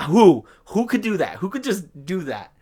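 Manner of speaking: high, loud disbelieving questions; exasperated disbelief, pitch jumping up; incredulous. Real, not acted. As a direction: burst out on the first word, then plead. Incredulous about the customer.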